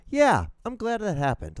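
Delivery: high-pitched mock gladness; feigned approval, pitched well up; mock satisfaction. Man mocking the idea of being glad afterwards, high mock satisfaction.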